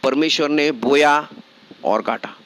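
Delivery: low, steady sermon exhortation; preacher pressing the point home; earnest preaching. Acted. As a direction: urge the congregation to sow goodness. Preaching exhortation, lower pitch.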